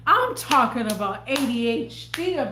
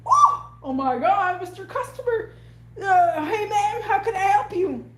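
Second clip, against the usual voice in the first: over-eager service voice; 8 semitones higher, 3 dB louder than usual. Retold or imitated. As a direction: fawn over the customer, hammed up. Mocking eager customer greeting.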